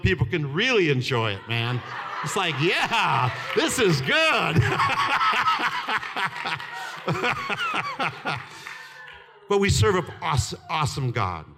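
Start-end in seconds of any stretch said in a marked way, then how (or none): 2.6-4.6 drawn-out, rising-pitched mock enthusiasm; hammed-up happy exclamation; delighted approval. Acts out delighted older viewer. 4.6-9.1 long, high belly laughter; extended, uncontrolled amused laughter; big laugh. Real, not acted. Laughs after his impression.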